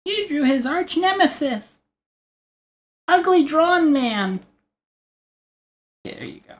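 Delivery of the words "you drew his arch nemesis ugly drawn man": high, slow whiny heckler voice; mock critic voice. Mock heckler whining to the artist about the drawing. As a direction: whine it like an offended fan.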